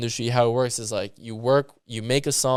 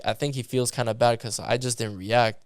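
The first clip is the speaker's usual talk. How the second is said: ordinary delivery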